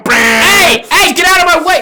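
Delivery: loud, high angry driver yell; angry shout. Pretend. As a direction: yell out the window at traffic. Angry driver yelling in skit.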